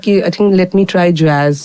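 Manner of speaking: normal talking voice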